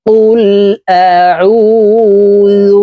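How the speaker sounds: أعوذ with the ain pressed from mid-throat, high and slow; correct tajweed. Shown as a demonstration. Teacher modelling the proper أعوذ with a throat-pressed ain. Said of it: "হলকের মধ্যখান থেকে চাপ দিয়ে উচ্চারণ".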